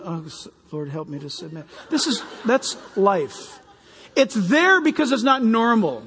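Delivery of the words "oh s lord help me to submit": much softer, sighing plea; mock pleading prayer. Preacher imitates wife praying, much softer and pleading. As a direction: pray it under your breath, mock weary. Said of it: "she's like going".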